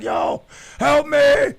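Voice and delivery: loud, high, panicked shouting from the cop car; cuffed in the back of the police car, shouting; frantic yelling. Retold or imitated. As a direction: scream to be let out. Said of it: "I was like".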